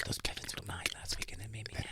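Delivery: very soft, unvoiced mock whisper; Christine. Mimicking Christine on an open mic, barely audible whisper. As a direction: imitate a coworker whispering secretly. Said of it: "look at Christine whispering into a microphone".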